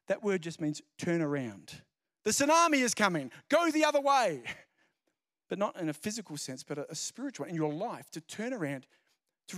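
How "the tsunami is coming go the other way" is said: high, loud urgent warning. Acted. Preacher warning people to turn around, urgent loud. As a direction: call out the danger.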